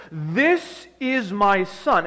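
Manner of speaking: very slow, loud, grand proclamation; attempted voice of God. Preacher portrays God speaking over the crowd, slow and loud. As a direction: boom it out word by word as God. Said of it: "wish I had a really deep voice to kind of portray this".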